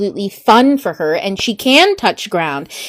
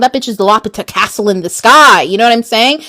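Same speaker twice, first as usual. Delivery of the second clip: ordinary talk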